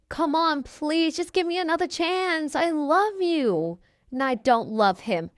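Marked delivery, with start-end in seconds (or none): 0.1-3.8 high, whiny begging, acted out; pleading boyfriend. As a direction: beg for another chance in a raised pitch. Cheating boyfriend begs for another chance.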